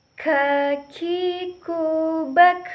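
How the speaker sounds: teacher's slow stretched letter demo; drawn-out demo. Shown as a demonstration. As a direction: draw out "Kakiku" slowly, then snap "bak" loud. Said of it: "Perhatikan contoh".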